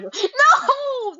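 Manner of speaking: high, drawn-out wailed no; anguished fan wail; dramatic protest. Real, not acted. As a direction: cry out in mock heartbreak. Protests imagining Launchpad.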